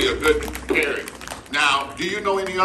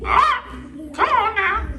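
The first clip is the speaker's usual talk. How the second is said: mimicking parrot. Parrot puppet copying his ventriloquist, high squawky mimicry.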